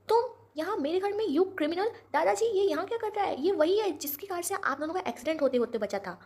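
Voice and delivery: high, loud furious outburst at the intruder; a granddaughter exploding at an unwelcome guest; shocked outrage. Acted. Kaya finds Prithvi in her house. (गुस्से और हैरानी में ऊँची आवाज़)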